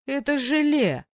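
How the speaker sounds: high, drawn-out teasing verdict; mock disapproval. A narrator's reading. Tor teases his shaking friend, mock disgust. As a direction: stretch it in put-on dismay. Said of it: "фальшиво нахмурился Тор".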